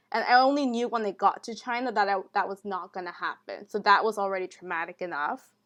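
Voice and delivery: normal talking voice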